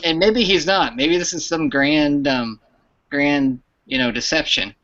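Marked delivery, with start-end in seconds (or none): none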